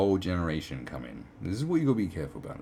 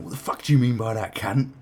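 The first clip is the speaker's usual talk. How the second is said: louder mock aggressive snarl; angry short man; 4 semitones higher, 9 dB louder than usual. Mocking an angry short man kicking off, loud. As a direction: play the touchy hothead picking a fight. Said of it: "they're like".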